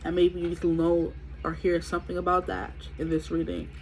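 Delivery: normal talking voice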